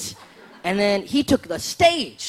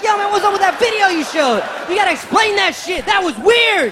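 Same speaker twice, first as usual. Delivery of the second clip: high, loud demanding; confronting; 11 semitones higher, 6 dB louder than usual. Retold or imitated. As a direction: call him out loudly. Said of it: "You'd be like".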